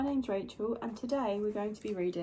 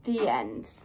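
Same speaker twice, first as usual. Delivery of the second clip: ordinary delivery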